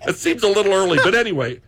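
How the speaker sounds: laughing through the words; chuckling while talking. Real, not acted. Joking host laughing about drinking.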